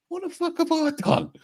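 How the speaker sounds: high-pitched incredulous retold outcry; baffled outburst. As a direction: squeak it out in mock disbelief, imitating himself. Retold baffled reaction to fan.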